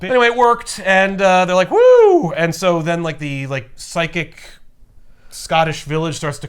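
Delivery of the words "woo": high, loud whoop; mock victory whoop; cheering. Crew celebrating that the plan worked, loud cheer.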